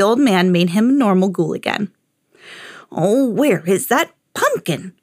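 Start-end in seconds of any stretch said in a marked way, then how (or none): 2.9-4.9 put-on slow, lightly croaked old man; croaking old man. A narrator's reading. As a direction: drag it out with a hint of croak. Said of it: "croaked the old man".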